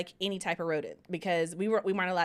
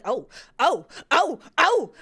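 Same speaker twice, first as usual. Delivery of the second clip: mom's rising, louder yelps; mock freaking out; 6 semitones higher, 7 dB louder, slower than usual. Daughter mimicking her mom meeting the spiders, mock freak-out. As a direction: imitate someone starting to panic, each cry higher. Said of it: "she started freaking out".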